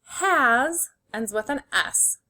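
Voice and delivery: ordinary talk, nothing marked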